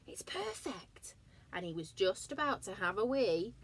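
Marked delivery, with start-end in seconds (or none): 0.1-1.2 slow, soft whispered delight. Acted. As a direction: breathe it in awe.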